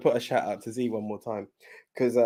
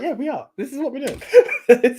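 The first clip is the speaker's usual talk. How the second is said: laughing reply; 12 semitones higher, 7 dB louder, slower than usual. Retold or imitated. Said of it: "I was like".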